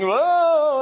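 high, loud mock wailing "oh"; mock mourner's wail. Preacher imitating hired mourners wailing, high, loud mock cry. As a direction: ham up a professional mourner's cry. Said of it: "all the wailing and crying".

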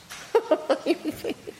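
high mocking laugh from Sarah; Sarah's laugh. Sarah laughing at God's promise, high laugh. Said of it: "and Sarah laughs".

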